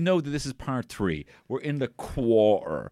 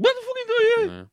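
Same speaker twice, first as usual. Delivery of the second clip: put-on much higher playful cry on hearing an Irish accent in a crowd; mock Irish shout; 4 dB louder, faster than usual. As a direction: call it out like a meerkat popping up.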